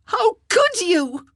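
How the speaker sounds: high, loud, slow scream; hysterical scream. A narrator's reading. Wife catches husband with Jane, screaming high. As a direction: shriek it at the cheating husband. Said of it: "she screamed, almost hysterical".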